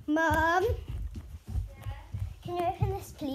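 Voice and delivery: loud, high call to Mum in the next room. Real, not acted. Girl calling her mum to open the Vaseline, loud and high.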